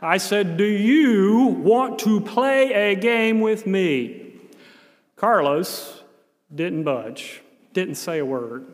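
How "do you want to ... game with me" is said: put-on slow, loud, overly clear words to a child. Volunteer to a silent boy, loud and slow. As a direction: spell out every word loudly, as if volume bridges languages. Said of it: "I spoke loudly and I spoke slowly".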